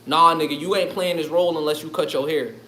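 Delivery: a hint of a strict producer; mock stern casting boss.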